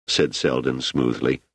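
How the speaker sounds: ordinary delivery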